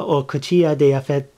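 ordinary talk, nothing marked

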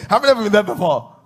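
laughing. Real, not acted. Preacher asking congregation, laughing.

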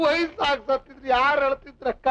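sobbing through the words, put on for the landlady; a hammed-up tearful plea; fake crying. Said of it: "ಅಳ್ಬೇಡ ತಮ್ಮ".

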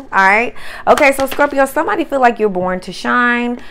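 usual speaking voice

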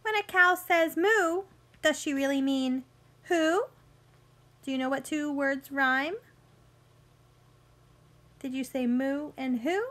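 bright storybook read-aloud for little ones; picture book voice for kids; reading to children. Acted. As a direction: read it like story time on the rug.

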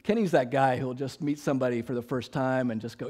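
normal talking voice

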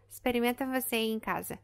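ordinary delivery

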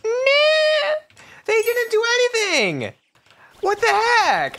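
shrieking disbelief way up high; outraged cry. Real, not acted. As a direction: wail at the bad luck. Failed hatch reaction.

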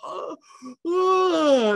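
big stretching yawn rising high; demo yawn. Shown as a demonstration. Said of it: "I wake up and I yawn and I go".

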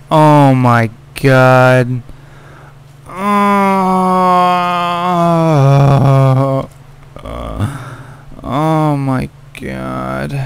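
slow stunned moan; shaken drawn-out oh my god. Real, not acted. Player recovering from the jump scare, shaken slow moan. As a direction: drag it out, still shaken.

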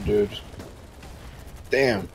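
ordinary talk, nothing marked

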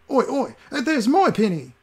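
very high, slow cockney beggar; insisting toothless beggar. Acted. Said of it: "the monocled beggar insisted".

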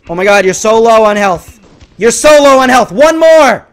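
rising high, loud urging; desperate shout. Real, not acted. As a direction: build to a yell for the final kill. Desperate for the last kill.

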